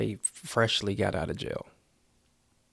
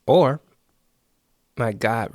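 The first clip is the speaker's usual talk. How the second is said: loud exasperated outburst; sudden loud exclamation; 10 dB louder, slower than usual. Acted. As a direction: burst out loud with Or. Exaggerated exclamation.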